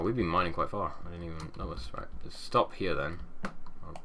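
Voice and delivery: normal talking voice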